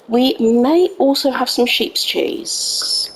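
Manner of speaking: ordinary talk, nothing marked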